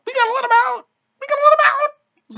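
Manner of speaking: very high, hammed-up wailing plea; mock crying. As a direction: squeal it like a mock sobbing woman. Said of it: "Claire's crying".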